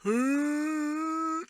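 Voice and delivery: long, high sustained howl; wordless creature call, held steady; lion head. Acted. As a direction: let out a high cartoon lion cry. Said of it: "like, a lion head".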